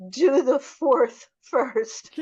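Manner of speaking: high, slow, pleading through tears; mock sobbing plea, drawn out; through sobs. Shostakovich begs his friend through tears, high and slow. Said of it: "through his sobs".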